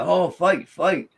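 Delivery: retold holler. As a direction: call it out like a crowd egging on a fight. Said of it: "they would holler".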